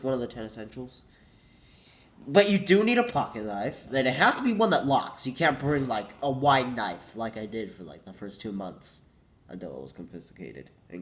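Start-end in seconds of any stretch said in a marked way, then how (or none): none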